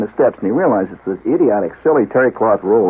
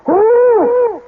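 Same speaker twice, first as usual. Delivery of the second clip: high-pitched call out of the shower; startled call; 4 dB louder than usual. Retold or imitated. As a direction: holler it up high, imitating a startled man. Calls out from the shower.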